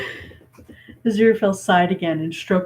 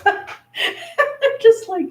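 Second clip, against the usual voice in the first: laughing; 5 dB louder, much slower than usual. Real, not acted. Laughing at the video.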